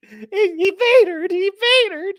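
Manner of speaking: slow, high, loud drawn-out laughing moan; hammed-up Vader wail; mock Vader moan. Mocking the Vader moment.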